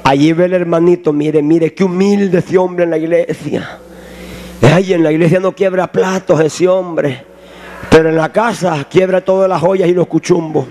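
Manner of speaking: low, sneering devil voice; mock the faithful as the devil would; sly devil. Preacher voicing the devil spying on a churchgoer, low and sneering. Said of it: "el diablo lo está buscando y lo está observando".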